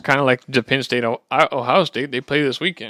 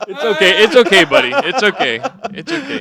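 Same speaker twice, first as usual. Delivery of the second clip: high, soothing there-there voice; soothing a scared dog; 6 semitones higher, 5 dB louder than usual. Pretend. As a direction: comfort him like a frightened pet. Consoling after a laughed-at pick.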